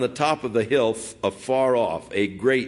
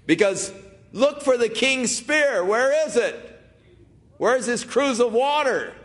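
yelling; 12 semitones higher than usual. Acted. Preacher voicing David taunting Abner from afar, shouting. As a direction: shout it like David across the hill.

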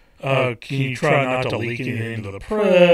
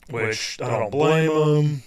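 normal talking voice

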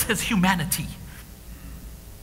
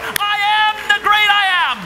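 much higher and louder, stretched out; climactic proclamation; 9 semitones higher, 4 dB louder than usual. Acted. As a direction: proclaim it at the top of your voice.

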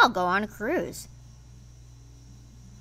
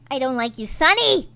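ordinary talk, nothing marked

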